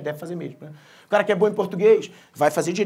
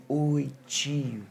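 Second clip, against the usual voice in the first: imitating a drawn-out, low, soft child's greeting; very slow talker; 6 semitones lower, 6 dB quieter than usual. Nephew waking up and greeting his uncle, very slow. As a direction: stretch each word out lazily and quietly. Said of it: "ele fala muito lento".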